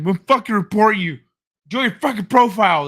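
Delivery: hammed-up furious gamer; mock angry rant. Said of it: "He's losing his shit".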